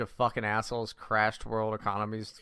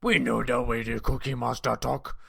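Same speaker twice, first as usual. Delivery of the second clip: Cookie Monster voice. Pretend. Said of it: "you gotta just do Cookie Monster".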